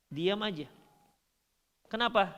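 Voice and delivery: normal talking voice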